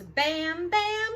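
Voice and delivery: excited rising bams; building bams. Acted. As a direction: bang it out bigger each time. Excavator banging, rising.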